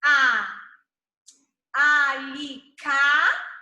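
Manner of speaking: "A, ali, cá" broken into loud, separate syllables. Shown as a demonstration. Teacher shows where the A sits, syllable by syllable. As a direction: sound out "A, ali, cá" with long pauses. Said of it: "o A é da sílaba do meio".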